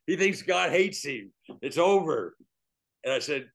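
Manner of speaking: normal talking voice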